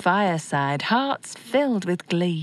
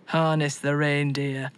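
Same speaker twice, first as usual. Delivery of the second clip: stern Santa; 6 semitones lower than usual. A narrator's reading. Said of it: "was Santa's reply".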